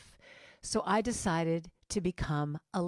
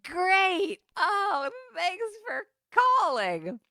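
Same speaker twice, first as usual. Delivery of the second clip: much higher, louder forced cheer, slowing near tears; fake cheerful thanks; 12 semitones higher, 6 dB louder, much slower than usual. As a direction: brave face over disappointment. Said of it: "I'm like".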